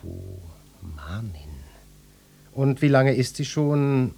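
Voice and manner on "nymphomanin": slow, hushed musing; musing half-whisper. Acted. Detective piecing clues together aloud, slow hushed musing. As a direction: draw it out softly, almost whispered. Detective muses over the word.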